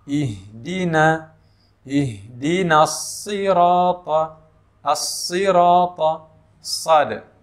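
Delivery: slow, drawn-out tajwid chant; slow melodic Quran recitation. Shown as a demonstration. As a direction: recite it slowly like tilawah. Recites verse.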